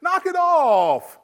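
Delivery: high, drawn-out whine; whiny voice. Retold or imitated. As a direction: whine it like a kid. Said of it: "stop whining in a whiny voice".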